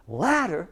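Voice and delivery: "Ladder" with the beat on LAD, second syllable light; stress on first syllable. Shown as a demonstration. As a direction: hit LAD in "Ladder", let -der drop away. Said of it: "he put the stress here".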